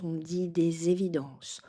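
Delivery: usual speaking voice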